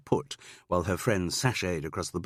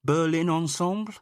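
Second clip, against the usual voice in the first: higher, louder, unsure guess at the name Berlin Ensemble; hesitant wrong guess; 11 semitones higher, 5 dB louder than usual. Acted. Student girl guesses the theatre's name, unsure. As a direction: say Berlin Ensemble as a tentative stab.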